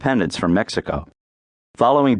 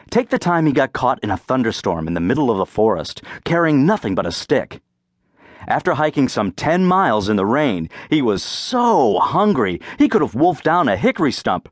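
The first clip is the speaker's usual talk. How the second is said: ordinary delivery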